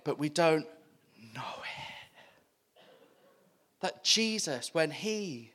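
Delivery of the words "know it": whisper. Acted. Preacher whispering to the congregation. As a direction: drop to a breathy whisper on know it. Whispered contrast after we don't.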